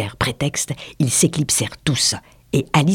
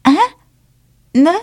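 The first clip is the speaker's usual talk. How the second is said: dry, cut-short mouse retort; terse angry mouse; curt, furious; 12 semitones higher, 6 dB louder than usual. A narrator's reading. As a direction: bite off each syllable. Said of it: "articula d'un ton sec la souris, furieuse".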